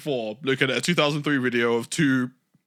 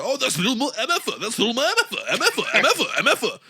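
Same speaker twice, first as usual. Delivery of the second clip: imitating Taz; 9 semitones higher, faster than usual. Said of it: "Taz had way too much fun mentioning".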